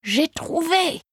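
high-pitched, lit-up eureka; delighted. A narrator's reading. Rosa finds her pseudonym idea, delighted. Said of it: "son visage s'illumine".

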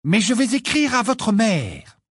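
high, loud threat through anger; trembling with anger. Acted. Hermione threatens the twins in anger. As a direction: hurl the threat with shaking fury. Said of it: "la voix tremblante de colère".

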